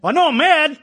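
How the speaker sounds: high, loud, indignant snap; hammed-up shout of "I know I'm mad"; mock angry. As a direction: play the grumpy person who's just mad. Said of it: "You ever been mad".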